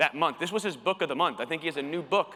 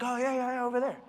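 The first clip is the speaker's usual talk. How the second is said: much higher, offhand mock brush-off; dismissive store owner; 8 semitones higher than usual. Store owner waving the salesman off again, mock high dismissive voice. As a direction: imitate the lazy boss pointing away.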